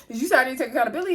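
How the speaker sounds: rapid, high question through a laugh; imitating an incredulous comeback; mock incredulous question. Retold challenge to the muttered remark.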